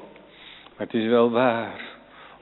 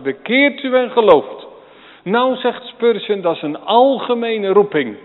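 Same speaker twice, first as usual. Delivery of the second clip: loud, high, proclaiming call; preaching at full force; 6 semitones higher, 7 dB louder than usual. Acted. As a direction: ring it out like a herald. Preacher quotes Spurgeon, rousing.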